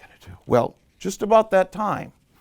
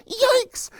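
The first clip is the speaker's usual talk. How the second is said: startled yelp. Acted. Rabbit bitten by mosquito, startled high yelp. Next animal yelps in the chain.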